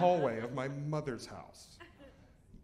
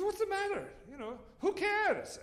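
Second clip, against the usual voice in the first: high careless granny voice; mother-in-law voice. Retold or imitated. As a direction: shrug it off like a carefree old lady.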